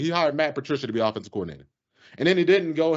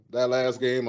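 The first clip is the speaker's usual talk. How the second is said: usual speaking voice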